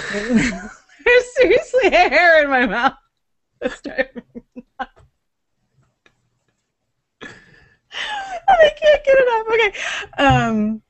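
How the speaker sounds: laughing helplessly, voice up high. Real, not acted. Hair stuck in mouth.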